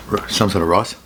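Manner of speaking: chewing while talking; words muffled by a mouthful of food; mouth full. Real, not acted. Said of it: "I'm talking with my mouth full".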